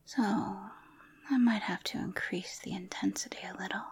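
very soft, breathy ASMR whispering. Acted. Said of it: "I'm going to try A S M R with you".

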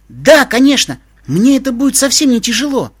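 high, loud and eager; joyful reply. A narrator's reading. Flattered pony agrees to carry the pack, joyful eagerness. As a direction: agree gladly, voice lifted right up. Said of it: "радостно отозвался жеребчик".

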